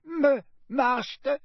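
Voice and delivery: high, tearful stammering elf voice. A narrator's reading. Weeping elf stammers at her master, high and tearful. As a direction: stutter out master through tears. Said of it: "Winky stammered".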